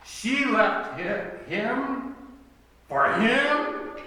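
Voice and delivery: high, slow and loud on each HIM; incredulous stress on HIM. Retold or imitated. Gossip about who she left him for, incredulous stress on HIM. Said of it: "the emphasis on the pronoun".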